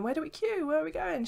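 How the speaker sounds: high-pitched, flustered questions; mock fluster. Lost visitors asking a lady for help, mock fluster. Retells their clueless questions.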